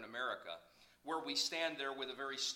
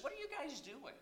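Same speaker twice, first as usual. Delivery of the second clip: Jesus questioning; 7 dB quieter than usual. Retold or imitated. Jesus voiced in the sermon.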